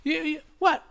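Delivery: high, loud, fast stooge blurt; mock-innocent high squeak; Curly voice. Voicing Belushi doing Curly.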